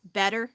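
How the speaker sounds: "better" with the T softened to a D. Shown as a demonstration. Streamer showing viewers how locals talk, T like a D. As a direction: say "better" with a quick D-like T. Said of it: "we say the T's like D's".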